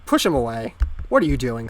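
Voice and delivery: ordinary talk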